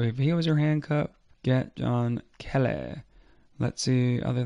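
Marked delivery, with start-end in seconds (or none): none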